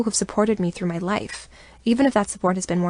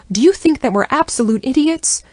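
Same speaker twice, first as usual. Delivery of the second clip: much higher, louder furious outburst; furious shout; 7 semitones higher, 8 dB louder than usual. Acted. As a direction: scream at her granddaughter. Grandmother furious at granddaughter.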